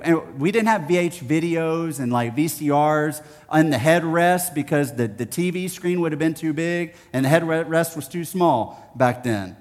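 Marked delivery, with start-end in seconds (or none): none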